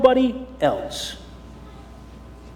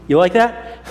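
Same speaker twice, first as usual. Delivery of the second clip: plain reading voice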